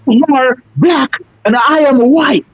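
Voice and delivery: high-pitched imitation of a girl's objection; protesting teenage girl. Teenage girl correcting the older man, high-pitched mock protest. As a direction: raise the pitch to mimic her arguing back. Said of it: "She go".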